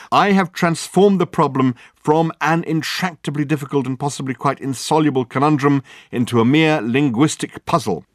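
loud, high, grandly pleased; grand proclamation. Acted. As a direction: announce it like a great discovery. Dirk proclaiming his clever solution.

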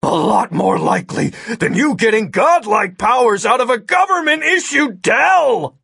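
loud, high retort climbing to a shout; heated retort. A narrator's reading. Agent argues with the hacker, heated shouted retort. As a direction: argue back, building to a yell.

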